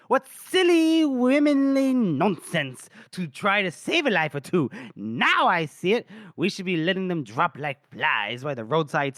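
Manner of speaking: hammed-up sarcastic tirade, stretching key words; theatrical sarcasm. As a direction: declaim it like a grand mocking speech. Acts lady's sarcastic tirade at officers.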